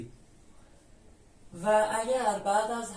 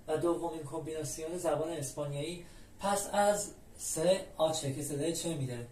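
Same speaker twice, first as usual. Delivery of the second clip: ordinary delivery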